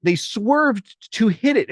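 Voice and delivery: high, exasperated disbelief; can't believe they hit it; incredulous. Real, not acted. Parent telling the host about the crash, high disbelief. Excited retelling of kids crash.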